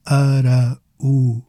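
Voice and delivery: "Araújo" slowly as ara, ú, jo; pauses around the stressed u in "Araújo"; broken into syllables. Shown as a demonstration. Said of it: "It breaks down as Araújo".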